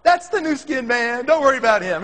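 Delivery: put-on cheery old lady. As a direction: announce him brightly like a kindly grandma. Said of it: "And she goes".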